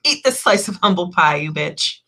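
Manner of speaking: a hint of a snarky tarot deck scolding its reader; sassy tarot deck. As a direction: tease like a smug deck of cards. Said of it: "It's like".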